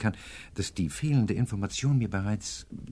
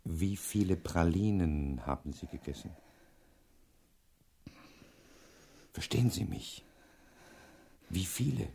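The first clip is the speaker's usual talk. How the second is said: softer, gentle questioning; soft bedside voice; 3 semitones lower, 5 dB quieter than usual. Acted. Professor questions a weak patient, gently. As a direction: speak softly to the sick woman.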